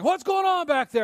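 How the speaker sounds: high, loud mock-dad holler; dad's yell. Preacher voices dad calling to back seat, imitated yell. Dad yelling in retold car quarrel.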